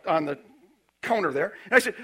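ordinary talk, nothing marked

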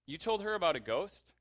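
sharp, loud "a ghost?"; startled boyfriend; surprised question. Retold or imitated. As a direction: caught off guard, calling her out.

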